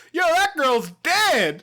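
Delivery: high, drawn-out mock announcement; hammed-up doctor blurting out bad news; indiscreet doctor. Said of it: "the doctor wasn't quiet".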